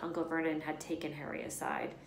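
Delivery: plain reading voice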